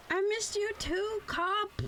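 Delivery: usual speaking voice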